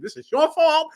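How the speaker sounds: mock blame. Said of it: "She goes".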